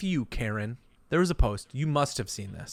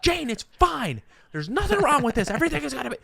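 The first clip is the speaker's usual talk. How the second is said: dad brushing it off; 12 semitones higher, 4 dB louder, faster than usual. Retold or imitated. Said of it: "he's going".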